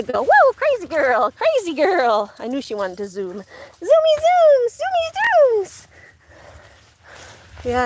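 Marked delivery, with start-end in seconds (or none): 0.2-2.3 high, slow and louder cooing; pet talk. Real, not acted. To the zooming dog, high drawn-out cooing. 3.4-5.9 very high, very slow squeaky voice; a cartoonish squeal of delight. Real, not acted. Cheering the zooming dog, very high slow squeaky baby talk.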